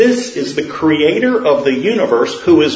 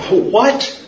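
much higher, startled; panicked disbelief; 9 semitones higher than usual. Acted. Man told to let go reacts, high and alarmed. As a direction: squeak out in alarm.

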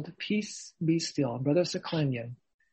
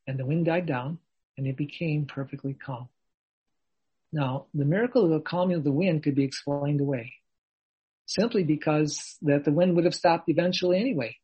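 normal talking voice

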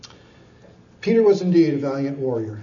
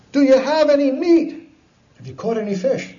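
calling from shore; 11 semitones higher, 4 dB louder than usual. Acted.

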